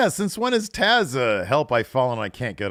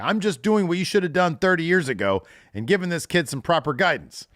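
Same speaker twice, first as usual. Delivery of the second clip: higher, louder preachy lecture; hammed-up Jericho lecture; 10 semitones higher, 6 dB louder than usual. Host voicing Jericho scolding Taz, higher and louder. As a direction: talk down to Taz from a high horse.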